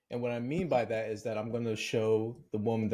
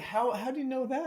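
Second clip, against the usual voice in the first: baffled; 12 semitones higher than usual. Retold or imitated. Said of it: "I was like".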